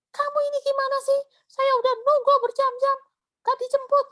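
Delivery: high, fast, loud scolding voice; retold scolding. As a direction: scold like a fed-up friend who waited hours. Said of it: "Saya dimarahin habis-habisan sama dia". (omelan marah yang ditirukan)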